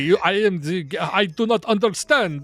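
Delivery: put-on loud, high Eastern European accent, baffled; Borat Zizek voice. Mocking a writer's interviews, high loud Eastern European accent.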